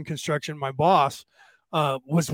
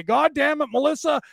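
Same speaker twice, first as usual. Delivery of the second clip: higher-pitched imitation of a yelling husband; mock yell; 9 semitones higher than usual. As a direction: mimic his exasperated holler. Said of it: "then he would yell whatever".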